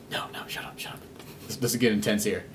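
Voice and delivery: hushed urgent shushing; whisper. Retold or imitated. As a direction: whisper so the theater can't hear. Kid hushing in theater.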